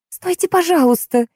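high, imploring plea; pleading. A narrator's reading. Martha begs the departing car to wait, pleading. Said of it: "умоляюще произнесла Марта".

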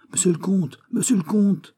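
high-pitched call, rising on the repeat; joyful cry. A narrator's reading. Raoul finds the count in the carriage, crying out. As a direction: cry out to someone just found. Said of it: "ouvrit ses bras en criant".

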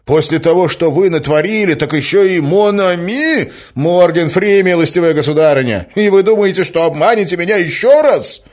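loud, high tirade of outrage; enraged. A narrator's reading. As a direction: rail at her in a fury. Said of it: "вскричал он в бешенстве". (бешеное негодование, на крике)

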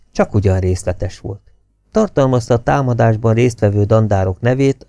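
ordinary delivery, nothing marked

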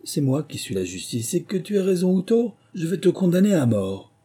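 high malicious verdict, building in volume; full of malice. A narrator's reading. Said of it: "plein de malice".

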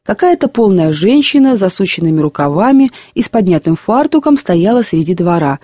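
ordinary delivery, nothing marked

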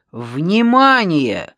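slow, loud military-style orders; commanding. A narrator's reading. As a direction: give firm orders with drawn-out words. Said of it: "скомандовал".

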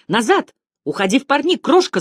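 high, urgent warning cry; urgent shout. A narrator's reading. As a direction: shout an order to get back, pitch climbing. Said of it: "закричал Томми".

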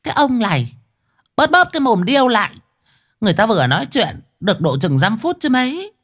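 high, fast scolding wife; nagging old wife. A narrator's reading. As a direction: snap back at the husband, high and quick. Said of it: "Bà Ái đưa mắt lườm lườm chồng rồi bảo".

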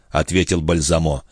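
plain reading voice

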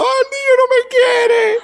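put-on high wailing cry of a child; crying child's song. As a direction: wail it like a child crying for no gifts. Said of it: "yo lloraba".

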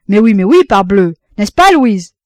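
loud, jovial cry of agreement; hearty exclamation. A narrator's reading. Father cheerfully calls out to son and wife, loud exclamation. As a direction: boom it out like a cheerful old father. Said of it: "Roland s'écria".

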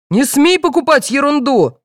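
high-pitched angry scolding; yelling father. A narrator's reading. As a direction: raise the pitch in an understated yell. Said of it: "заорал отец".

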